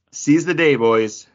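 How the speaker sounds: put-on rally the boys like the film teacher; rousing quote. Acting out Keating's rousing line.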